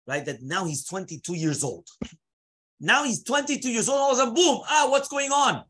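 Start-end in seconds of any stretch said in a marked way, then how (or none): 2.8-4.0 much higher, animated restatement; dramatic retelling. Acted. Teacher dramatizing the sudden turn for students, excitedly. 4.0-5.6 high, loud burst of astonishment; acted startled surprise. Teacher acting out a sudden realization at twenty-two, startled. Acts out sudden bewildered awakening.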